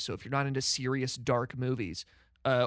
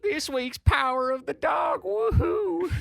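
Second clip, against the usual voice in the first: high, slow shouted cowboy announcement; drunk cowboy voice; 11 semitones higher, 4 dB louder, slower than usual. Pretend. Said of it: "I sound like the drunkest cowboy".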